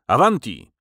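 loud rallying shout; calling out. A narrator's reading. Nostromo leading his men again, loud call. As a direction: shout the command to advance. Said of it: "wołając".

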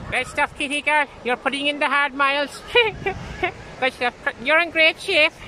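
high, loud cheery call to a passer-by; mock-hearty shouted encouragement to a jogger. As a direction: cheer her on from a distance. Calling out to passing jogger.

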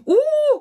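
very high, loud "ooh"; delighted squeal. A narrator's reading. Gossipy lounger clapping her hands, squealing. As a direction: squeal it right at the top of the voice. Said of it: "quietschte Liege zwei".

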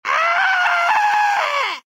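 high piercing shriek of outrage. Acted. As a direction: shriek like a wounded miser. Villain shrieks over stolen gold.

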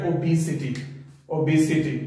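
"ओबेसिटी" as o-BEE-sity; falling "ओबेसिटी", long ee in the middle; stressed correct pronunciation. Shown as a demonstration. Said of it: "stress डालेंगे यहाँ पे syllabus पे".

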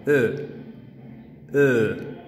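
"E" short then longer; closed then open rounded E. Shown as a demonstration. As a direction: contrast the two "E" sounds. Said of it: "la primera es".

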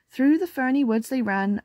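ordinary delivery, nothing marked

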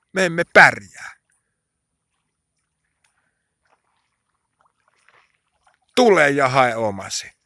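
crying out. Acted. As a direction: plead out loud, hitting the first words. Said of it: "jossa me huudetaan". (korotetulla äänellä huudettu rukous)

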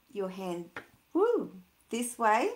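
plain reading voice

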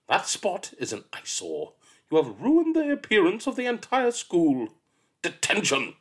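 high, loud disgusted teacher; spitting fury. Acted. Miss Seeth spits at the spotty child, furious. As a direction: spit the words out in fury. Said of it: "she'd spit".

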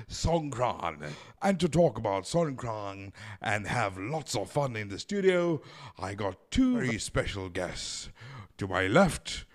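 normal talking voice

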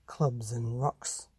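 ordinary delivery, nothing marked

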